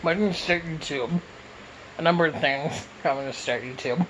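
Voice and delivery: ordinary delivery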